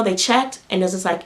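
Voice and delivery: usual speaking voice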